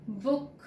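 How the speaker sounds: book with a short, light oo; teacher contrasting short oo in book. Shown as a demonstration. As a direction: clip the oo in book. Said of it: "Let me compare it again".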